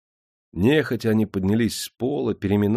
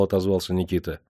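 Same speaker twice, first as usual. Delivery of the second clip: ordinary delivery, nothing marked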